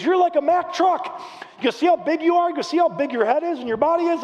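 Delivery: high-pitched, forceful pep talk; emphatic coach voice, pitched well up; retold fired-up coach. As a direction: imitate a coach hyping up his player. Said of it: "he was going all fired up".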